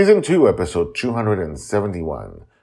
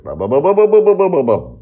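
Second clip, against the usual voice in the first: mock stammer; 12 dB louder, faster than usual. Stammers on the word biggest.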